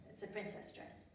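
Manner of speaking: ordinary delivery, nothing marked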